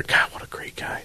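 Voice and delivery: unvoiced whisper, a quick aside. Real, not acted. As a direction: breathe it off-mic to your co-host.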